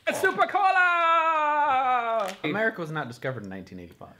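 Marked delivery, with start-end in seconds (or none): 0.1-2.3 high, slow hammed-up ad voice; mock commercial announcer. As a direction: sell it like a cheesy TV ad. Ad-like announcement of candy.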